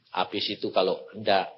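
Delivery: ordinary talk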